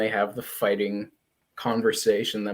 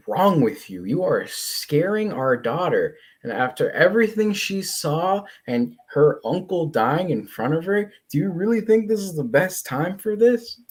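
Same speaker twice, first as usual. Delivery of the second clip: higher, emphatic telling-off; acted scolding; 6 semitones higher than usual. Wife scolding husband for scaring their daughter, acted exasperation. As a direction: build the reproach as you go. Voices Eve scolding Mark.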